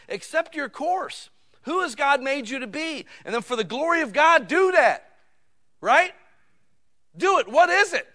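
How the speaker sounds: high, loud, urgent exhortation; full-force preaching. Real, not acted. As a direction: press the congregation with rising force.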